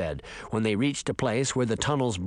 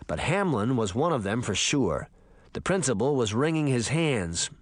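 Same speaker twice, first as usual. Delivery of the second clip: ordinary delivery, nothing marked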